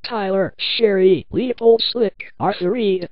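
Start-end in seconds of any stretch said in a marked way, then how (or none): none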